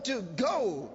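usual speaking voice

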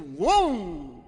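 drawn-out vroom swooping up and falling; imitated engine. Shown as a demonstration. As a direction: mimic a car engine starting up. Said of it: "Have you seen a vehicle?".

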